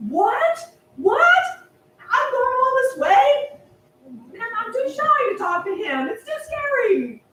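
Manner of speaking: high, loud, stunned disbelief; dismayed outcry. Acted. Shy boy told no by the judge, high dismayed cry. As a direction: shriek it in shock.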